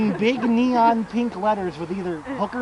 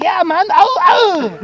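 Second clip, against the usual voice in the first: loud, very high-pitched hammed-up yelps; imitated excited yelping; 10 semitones higher, 8 dB louder, slower than usual. Younger guy answering about a video game, comically yelping. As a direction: blurt agreement, then yelp twice. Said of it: "his onomatopoeia for everything".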